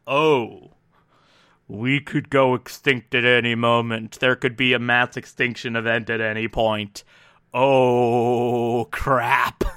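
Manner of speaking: low, foreboding dread, slightly hammed-up; ominous. Host voicing the dawning thought of extinction, mock-ominous. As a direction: voice a low mock doom, drawing out the last exclamation. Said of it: "it's this very ominous".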